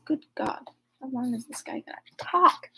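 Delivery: soft exasperated mutter; exasperated aside. Real, not acted. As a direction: grumble at the endless text. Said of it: "aside about the long text".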